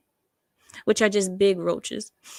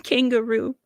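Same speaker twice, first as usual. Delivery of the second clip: ordinary talk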